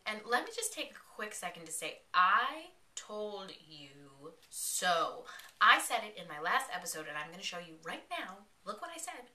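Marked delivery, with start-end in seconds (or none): 2.1-5.2 "I told you so" drawn out very slowly; gloat, stretching each word of "I told you so"; slow, savoured "I told you so"; triumphant gloating. Real, not acted. Gloating over correct prediction.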